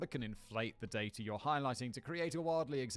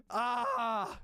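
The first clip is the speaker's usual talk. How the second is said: mock scream; 10 semitones higher, 3 dB louder than usual.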